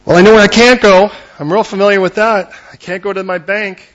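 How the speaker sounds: loud, exasperated outburst; shouting Philip. Acted. Preacher voicing Philip answering the question, shouting in frustration. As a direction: throw it back at the question, fed up. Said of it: "Philip, where are you gonna go?".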